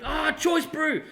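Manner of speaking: high, cheery Kiwi accent, self-mocking; imitating a friendly Kiwi, a bit overdone; New Zealand accent. Friendly Kiwi apologising for a convention bump, mock New Zealand accent. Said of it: "I can't do a proper New Zealand accent".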